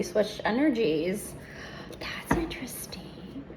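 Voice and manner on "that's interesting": hushed aside; whispering. Real, not acted. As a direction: murmur it to yourself.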